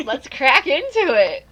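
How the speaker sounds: loud, drawn-out, swooping kickoff cry; big playful rally call; hyped catchphrase. Pretend. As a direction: launch the show with gusto. Show catchphrase called out.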